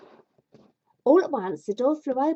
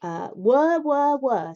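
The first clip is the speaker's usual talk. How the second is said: mock spinning wheel; 5 semitones higher, 6 dB louder, slower than usual. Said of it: "spinning wheel noise imitation".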